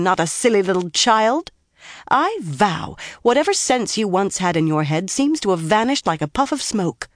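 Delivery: dressing down. A narrator's reading. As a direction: dress her down like a naughty girl. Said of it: "tried to dress her down".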